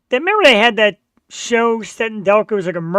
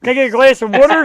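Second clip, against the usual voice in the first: a bit higher and louder Delco repeat; Delco accent, repeated; 4 semitones higher, 5 dB louder than usual. Retold or imitated. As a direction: say the order again in Delco, louder for the confused waitress.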